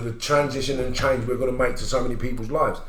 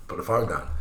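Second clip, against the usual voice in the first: ordinary talk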